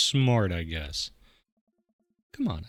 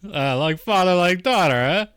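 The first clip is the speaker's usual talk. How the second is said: louder, higher dad boast; a hint of a proud father; lightly voiced proud dad; 6 semitones higher, 6 dB louder than usual. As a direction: show off a pun to the table.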